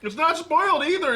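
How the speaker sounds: high, loud, theatrical disgust; dramatic disgust. Real, not acted. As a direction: overplay the disgust. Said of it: "You're dramatic".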